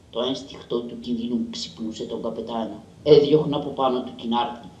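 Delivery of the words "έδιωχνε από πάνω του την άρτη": plain reading voice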